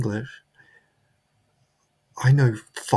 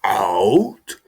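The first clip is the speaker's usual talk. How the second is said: boss shouting; 8 dB louder than usual. Retold or imitated. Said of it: "he said again".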